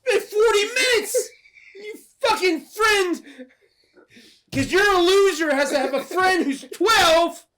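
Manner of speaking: loud, high comic tirade; mock outrage. Dad berating Billy over his young friend, mock furious yelling. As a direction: berate in a hammed-up shout with laughter creeping in.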